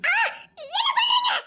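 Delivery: very high, loud alarmed cry; frightened scream. Acted. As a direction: shriek it in fear. Girl frightened as he approaches. (பயந்த அலறல்)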